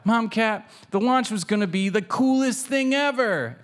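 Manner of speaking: high, eager kitten voice; Pancake. A narrator's reading. Kitten telling her mom about the launch, high and eager. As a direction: gush up high like a thrilled little cat. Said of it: "Pancake says to her mom cat".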